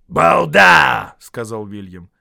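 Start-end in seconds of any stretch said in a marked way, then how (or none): 0.1-1.2 loud, high troll name-calling; shouted insult. A narrator's reading.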